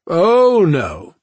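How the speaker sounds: loud despairing groan. Acted. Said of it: "Cha groaned loudly".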